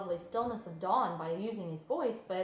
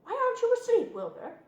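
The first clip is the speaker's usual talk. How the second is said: goose voice; 13 semitones higher, 6 dB louder than usual. A narrator's reading. Goose character voice.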